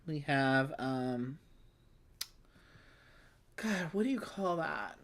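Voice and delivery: normal talking voice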